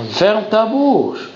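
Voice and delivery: high, very slow stern order; mock command. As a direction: tell him off, drawing it out.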